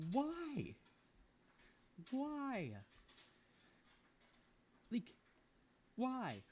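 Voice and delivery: high, drawn-out why of despair; squeaky exasperated whine; exasperated wail. Real, not acted. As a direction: wail it to the sky. Ranting about group work.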